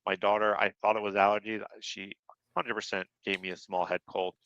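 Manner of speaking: ordinary talk, nothing marked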